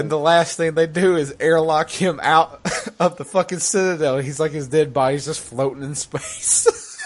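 can't stop laughing while telling it; giggling all the way through. Real, not acted.

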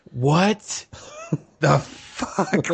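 retold shock, word by word with long gaps; mock stunned, halting delivery; stunned disbelief. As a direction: act out being dumbfounded, pausing after the first word. Retold shock at game reading him.

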